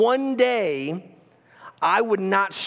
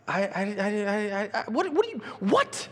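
flustered stammer; 2 semitones higher, 4 dB quieter than usual. Retold or imitated. Young John caught off guard.

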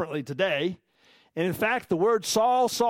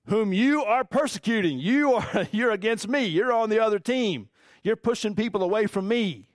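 raised-pitch, emphatic accusation; insistent confrontation; 5 semitones higher than usual. Retold or imitated. Jesus telling Saul he is against him, insistent and emphatic. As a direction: press each charge home insistently.

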